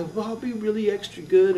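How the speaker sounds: put-on high child's wheedling voice; pleading little kid. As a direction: promise mom like an eager boy. Child bargaining with mom again.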